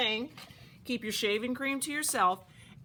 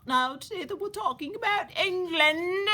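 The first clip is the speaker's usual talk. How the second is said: put-on English accent; 10 semitones higher, 5 dB louder than usual. Camp teacher opening the teapot lesson, in a put-on English accent. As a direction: greet the class in a hammed-up English accent. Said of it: "I forgot I was supposed to be speaking in English acc".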